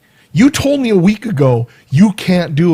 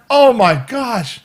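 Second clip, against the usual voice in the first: high, slow oh my gosh; patient reeling from the hammering, slow and high; stunned oh my gosh; 6 semitones higher, slower than usual. Retold or imitated. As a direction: drag it out in disbelief. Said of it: "I'm like".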